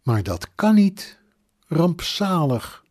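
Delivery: high-pitched dismay, slowing on the last word; shocked disbelief. A narrator's reading. Mother hears of a death, shocked disbelief. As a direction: refuse to believe it, then draw out the dismay.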